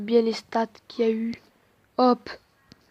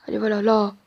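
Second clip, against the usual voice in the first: usual speaking voice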